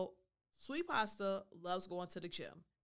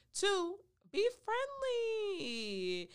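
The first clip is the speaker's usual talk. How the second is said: high, loud, long-held last word; singsong announcement; 13 semitones higher, 5 dB louder, much slower than usual. Real, not acted. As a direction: sing out the tip, very slowly. Announcing her second tip, drawn out.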